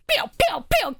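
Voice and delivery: quick playful shooting noises; mock gunfire pews. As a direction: imitate video game gunshots. Imitated game gunfire.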